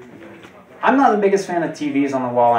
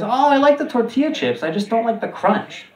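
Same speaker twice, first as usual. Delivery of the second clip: put-on much higher, airy girlfriend voice; mimicking the ex defending soggy chips, much higher; high mock ex voice; 10 semitones higher than usual. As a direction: play her as sweetly clueless. Quoting ex's odd chip habit.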